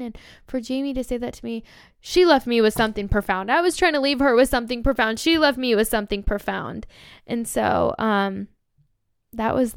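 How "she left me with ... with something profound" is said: louder, insistent emphasis on each phrase; emphatic amazement. Real, not acted. Fan marveling that her idol left her something profound, emphatic. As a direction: drive the contrast home, raised voice.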